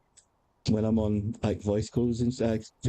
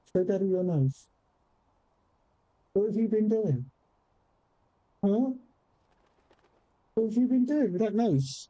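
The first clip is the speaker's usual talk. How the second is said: pet talk; 8 semitones higher than usual. Real, not acted. Owner fussing over the cat's sore nose, in high baby talk.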